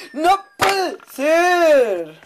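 very slow, high, loud cry of disbelief; drawn-out despairing shout. Real, not acted. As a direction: wail it out in dismay. Dismay after the near fall.

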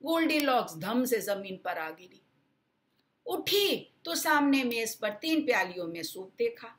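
plain reading voice

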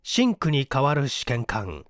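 ordinary delivery, nothing marked

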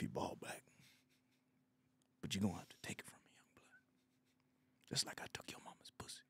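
fast threatening whisper; menacing whisper. Acted. Old man whispering a dirty threat to the kid. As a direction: hiss the threat under the breath.

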